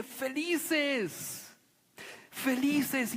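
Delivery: joyful Jesus. Retold or imitated. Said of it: "Jesús dice".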